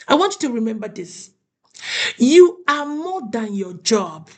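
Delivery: ordinary talk, nothing marked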